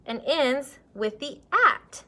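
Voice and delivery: "at" drawn out slowly; exaggerated word endings. Shown as a demonstration. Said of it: "this word begins with the k".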